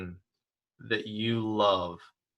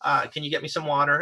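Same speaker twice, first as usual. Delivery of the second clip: lightly voiced customer, pitched higher; politely flag down a waiter in a higher voice; imitated customer; 5 semitones higher than usual. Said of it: "one of the customers ... said".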